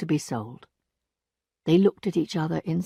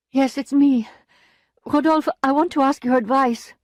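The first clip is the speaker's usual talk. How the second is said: higher, louder woman's voice; Emma's pleading voice; 6 semitones higher, 4 dB louder than usual. A narrator's reading. Emma coming to Rodolphe for advice. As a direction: come asking for help.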